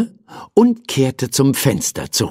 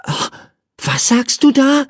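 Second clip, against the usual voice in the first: put-on high, curious little boy. A narrator's reading. Boy questions the bird at his window, curious. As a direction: ask it as an inquisitive child. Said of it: "fragte ihn Michael neugierig".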